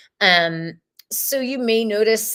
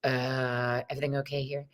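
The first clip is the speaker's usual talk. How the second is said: calm check-in; 6 semitones lower, 8 dB quieter, faster than usual. Retold or imitated. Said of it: "the other one's like".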